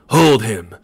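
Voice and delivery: loud raving call for help; loud furious shout. A narrator's reading. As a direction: shout after the vanished rider. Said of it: "still raved and danced".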